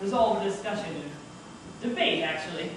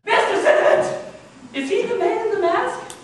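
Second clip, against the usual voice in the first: exasperated demand; 11 semitones higher, 8 dB louder than usual. Acted. Narrator demands an answer.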